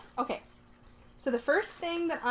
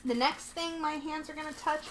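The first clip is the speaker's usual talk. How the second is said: usual speaking voice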